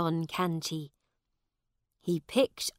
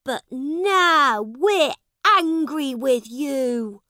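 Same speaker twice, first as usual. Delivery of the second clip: put-on angry boys; 8 semitones higher, 11 dB louder than usual. Boys turn on the prince.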